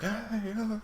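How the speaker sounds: very high-pitched imitation of sailors shouting; muffled-commotion shouts, acted out in a high voice; mock distant shouting. Game master imitating shouting sailors up on deck, high mock yells. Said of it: "running footsteps and shouting".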